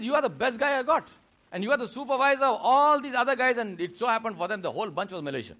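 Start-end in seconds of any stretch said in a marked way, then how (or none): 0.0-3.6 higher and louder, pleading with astonishment; the manager can't believe his best man is quitting; retold disbelief. Manager pleads with his supervisor, higher and louder in disbelief. Manager stunned, praising the worker.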